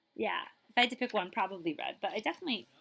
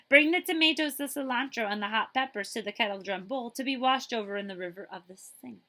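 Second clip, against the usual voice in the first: lively storybook reading; reading to children; 4 dB louder than usual. Acted. Librarian reading a picture book to children, lively. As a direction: read it like story time for kids.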